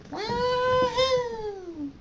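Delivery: gleeful scream. A narrator's reading. Little girls flying on a dragon's back, shrieking whoop of joy. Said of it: "The girls scream".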